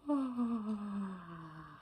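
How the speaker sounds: long slow sigh; relaxed sigh. Shown as a demonstration. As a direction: let out a slow breath. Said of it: "Sigh a little bit".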